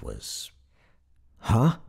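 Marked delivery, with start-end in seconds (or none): 1.4-1.8 higher, louder questioning blurt; baffled. A narrator's reading. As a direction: blurt it out, totally lost. Dazed passenger replies, baffled.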